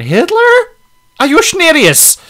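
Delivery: a hint of a Little Nicky voice; mock incredulous. Host retelling his reaction on his show, high mock disbelief. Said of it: "And I just went".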